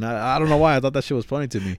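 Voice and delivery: ordinary talk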